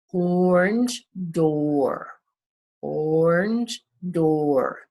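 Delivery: orange door said slowly, each vowel drawn out; slow teacher modelling of orange door; slow phonics demo. Shown as a demonstration. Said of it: "The O R in orange and the O O R in door make the or sound".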